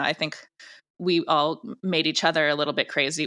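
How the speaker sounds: ordinary talk, nothing marked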